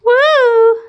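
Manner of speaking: high, loud whoop; excited woo. Real, not acted. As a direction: let out a happy whoop. Excited cheer to pet.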